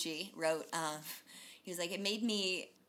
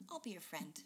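soft comforting voice; 3 semitones lower, 11 dB quieter than usual. Pretend. Voicing the comforting voice.

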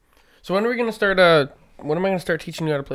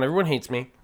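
usual speaking voice

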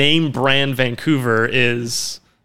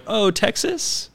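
clueless American; 9 semitones higher, 3 dB quieter, slower than usual. Retold or imitated. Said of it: "They're like".

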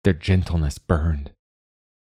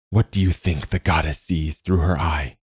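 ordinary delivery, nothing marked

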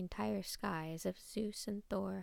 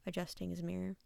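plain reading voice

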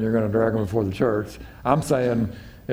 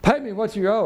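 high, pressing demand; threatening demand; 9 semitones higher than usual. Retold or imitated. Servant throttling his debtor, high threatening demand. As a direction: squeeze the debt out of him. Grabbing fellow by throat.

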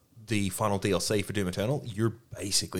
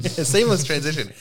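laughing, mock-grand repeat of his phrase; teasing echo; 6 semitones higher, 5 dB louder than usual. Real, not acted. Echoing the other's segue joke.

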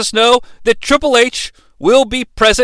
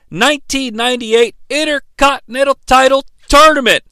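ordinary talk

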